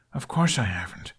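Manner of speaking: usual speaking voice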